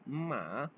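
falling-rising माँ for horse; Chinese third tone mǎ. Shown as a demonstration. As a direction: dip माँ and lift it. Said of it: "horse को बोलते हैं".

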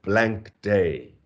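"blank day" very slow, both words weighted; drawn-out, separate beats on "blank" and "day"; two stressed syllables. Shown as a demonstration. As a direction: lean equally on "blank" and "day". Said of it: "stressed, stressed".

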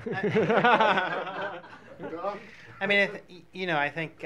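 loud real laugh spilling into words; laughing start. Real, not acted. Guest laughing at the Venn diagram question, loud. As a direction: laugh out, then start talking.

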